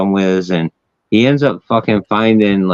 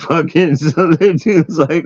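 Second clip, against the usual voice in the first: higher voice, laughing through the words; 7 semitones higher than usual. Real, not acted. Storyteller cracking up over the exploding snow branch, laughing. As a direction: talk through real giggles.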